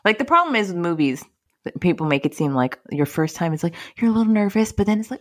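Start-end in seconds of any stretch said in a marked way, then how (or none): none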